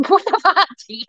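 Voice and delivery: high-pitched laugh through the words; baffled laughing. Real, not acted. Streamer totally lost by T's message, baffled laughing. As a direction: crack up in real bewilderment.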